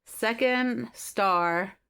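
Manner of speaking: slow, dreamy recitation; slow reading of an engraved quote; reading aloud. Shown as a demonstration. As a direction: read it like a storybook line. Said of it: "I'll read it to you".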